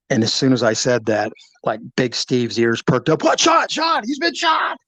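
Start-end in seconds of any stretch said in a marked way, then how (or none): 3.2-4.8 high, frantic shout of disbelief; alarmed shout. Retold or imitated. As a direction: cry out the news in panic. Startled colleague reacts to news.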